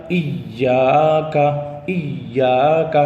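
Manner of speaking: ایاک stretched slowly and chanted; drawn-out recitation. Shown as a demonstration. Quran recitation drill. (ایاک کو کھینچ کر)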